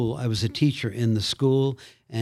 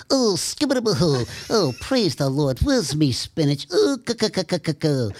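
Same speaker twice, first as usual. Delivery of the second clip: higher cartoon sailor voice with a cackle; Popeye impression; 3 semitones higher than usual. Shown as a demonstration. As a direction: do Popeye praising the Lord. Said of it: "So y-, you do Popeye?".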